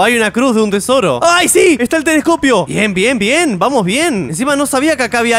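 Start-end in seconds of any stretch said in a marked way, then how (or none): 1.2-2.7 high, loud burst of excitement; excited discovery. Real, not acted. Player spots the telescope by chance, excited. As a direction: cry out at a lucky find.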